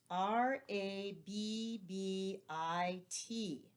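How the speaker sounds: very slow spelling of "R A B B I T", one letter at a time; teacher spelling the word rabbit for the class, very slowly; spelled letter by letter. Shown as a demonstration. Letter by letter.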